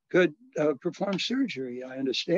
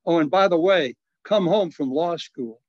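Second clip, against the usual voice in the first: bishop's last words, louder and slowed down; retold bishop's parting order; 4 semitones higher, 6 dB louder than usual.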